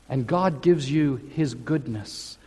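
ordinary talk

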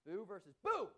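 loud, punchy boo; jump-scare the room with it; emphatic boo. Shown as a demonstration. Preacher shows the congregation an exclaimed boo. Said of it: "and then I said boo with an exclamation mark".

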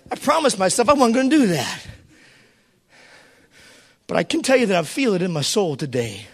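ordinary talk

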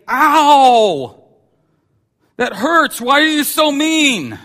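high, loud hurt complaint; whining child. Acted. Believers complaining to God like a hurt child. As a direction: cry out like a wounded, whiny kid.

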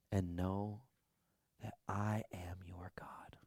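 soft whisper, slow and tender; still small voice. Acted. God calming burnt-out Elijah, whispered tenderly. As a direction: speak as God, barely above a breath. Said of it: "still small voice".

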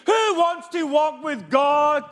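high, loud, slow call to the crowd; fiery preacher's opening call; preaching at full force. Acted. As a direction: preach it out, rallying the room. Preacher calls out to congregation.

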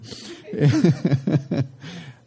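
longer embarrassed chuckle at the slip; amused laugh. Real, not acted. Preacher chuckling after misreading scripture, amused laugh. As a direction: laugh it off, then regroup.

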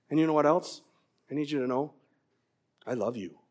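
soft, low retold declaration of love; tender fatherly confession. Voices dad saying I love you.

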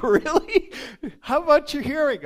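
high, loud, laughing through the words; can barely ask for laughing; laughing disbelief. Retold or imitated. Nurse asks deaf patient about his hearing, laughing high and loud.

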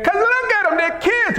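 high, hammed-up protest to a buddy; exasperated disciple. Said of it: "They said like this".

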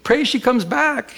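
high, accented, pleading imitation; imitating crying plea. Pastor imitating the weeping man begging for his wife. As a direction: mimic a tearful man begging for prayer. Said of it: "And he's crying".

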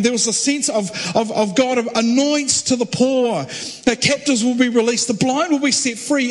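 preacher building to a passionate peak; preaching at full force. Acted. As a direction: lift it into a fervent proclamation.

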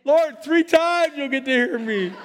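high, loud playful gush; hammed-up delight; mock excited. Playful reply voiced high.

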